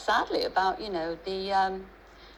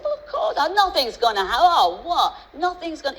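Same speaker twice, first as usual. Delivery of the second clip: put-on much higher, indignant boys' protest; shocked kids; 7 semitones higher, 5 dB louder than usual. Sons protesting to their dad, high and indignant. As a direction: squeal out the sons' disbelief. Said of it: "And they said".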